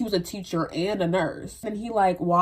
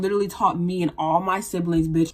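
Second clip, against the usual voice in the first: normal talking voice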